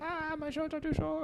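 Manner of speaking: high, soft squeaky leg voice; a leg complaining in a small high voice; voicing a leg. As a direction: give the leg a tiny whiny voice.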